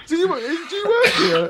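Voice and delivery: high, slow, bewildered question; baffled disbelief. Retold or imitated. To the rude chip shop man, utterly bewildered and high. Confused customer asking.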